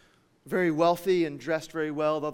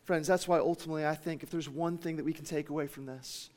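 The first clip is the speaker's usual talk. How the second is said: ordinary delivery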